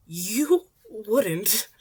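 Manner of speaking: loud, sharp dare; teen snapping back at the hero; defiant challenge. Acted. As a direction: call his bluff. Izuku challenges the threat.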